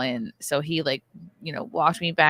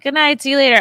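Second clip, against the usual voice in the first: sing-song goodbye; 7 semitones higher, 5 dB louder, faster than usual. Retold or imitated. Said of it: "I was like".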